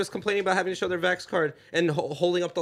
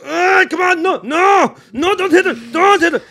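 high, very loud pleading yell; hammed-up frantic "no, no" yelling; panicked restraining shout; 12 dB louder, slower than usual. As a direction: shout as if holding someone back. Acts out restraining himself, shouting.

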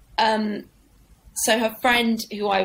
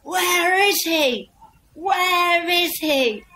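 much higher, slow mock yell; chief heckler; 8 semitones higher, 3 dB louder, much slower than usual. Chief heckler demands the next comic, slow mock yell. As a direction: bellow it like a drunk hunting his target. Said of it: "got up and was like".

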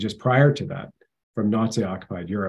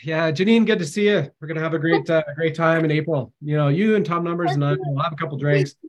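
put-on higher, jolly Irish accent, pub-fresh greeting; Mark Atwood in an Irish accent; 5 semitones higher, 3 dB louder than usual. Host playing Mark Atwood, greeting Janine from the pub, in an Irish accent. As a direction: play a cheery Irishman just back from the pub in an Irish accent. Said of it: "I'm doing an Irish accent".

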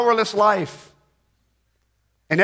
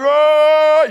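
high, loud drawn-out joyride yell; yelling whoop; 7 semitones higher, 7 dB louder than usual. Retold or imitated. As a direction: whoop it out long from the back of a pickup. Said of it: "yelling, looking forward".

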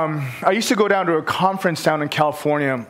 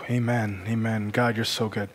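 hushed prayer; 4 semitones lower, 4 dB quieter than usual. Acted. Pastor softly praying over the congregation, hushed. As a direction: pray quietly over the room.

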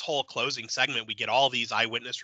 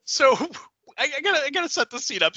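laughing; 13 semitones higher, faster than usual. Real, not acted. Laughing as she starts.

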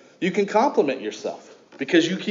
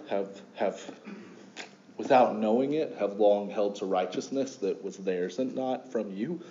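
soft, hushed pastoral prayer voice; 7 semitones lower, 7 dB quieter than usual. Acted. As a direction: pray it low and quiet, reverent. Pastor leads opening prayer.

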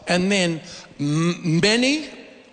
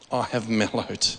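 softer, laughing through the word; 9 semitones lower, 5 dB quieter than usual. Shown as a demonstration. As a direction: chuckle at yourself mid-line.

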